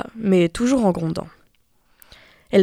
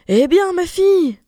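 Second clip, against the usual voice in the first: a loud, much higher call; eager cry; 11 semitones higher, 5 dB louder than usual. A narrator's reading. Mother calling eagerly to her returning daughter. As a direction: shout out to greet her. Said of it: "elle lui cria".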